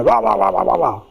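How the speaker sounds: nagging gibberish, falling at the end; wordless imitation of telling off; mock scolding. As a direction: rattle off "rah rah" like a lecturing parent. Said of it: "not the time to go".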